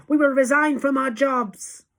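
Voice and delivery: high, loud eager pledge; crowd cry. A narrator's reading. Witches promising their leader, high loud cry. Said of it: "they cried".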